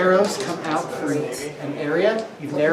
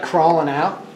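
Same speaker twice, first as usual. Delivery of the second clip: ordinary talk, nothing marked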